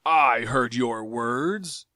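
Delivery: slow, cold deliberate menace; each word a threat; menacing accusation. Acted. Tario accusing Jav, slow and menacing.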